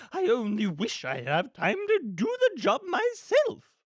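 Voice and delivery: high, loud, exasperated grande dame; impatient villainess. Acted. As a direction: relish the cruelty, swooping theatrically.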